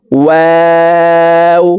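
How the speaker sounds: "ওয়াও" with round lips, held very long on a high level tone; round-lipped, stretched waw. Shown as a demonstration. Teacher demonstrating waw, long round-lipped hold. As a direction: draw out "ওয়াও" as one steady chanted note. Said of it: "ঠোঁট গোল করে উচ্চারণ করতে হবে".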